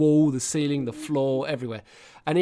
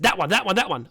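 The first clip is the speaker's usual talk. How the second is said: higher, insistent repeated pick; imitating eager child; 6 semitones higher, 4 dB louder than usual. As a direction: mimic a child jumping at the answer. Child eagerly picks the allowed word.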